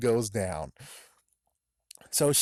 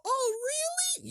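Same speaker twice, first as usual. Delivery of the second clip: mock surprise; faster than usual. Character's surprised reply.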